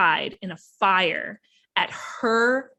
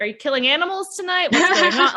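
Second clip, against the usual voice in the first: mock witty quip; 8 semitones higher, 4 dB louder than usual. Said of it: "She's like".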